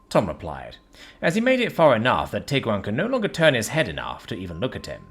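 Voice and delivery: plain reading voice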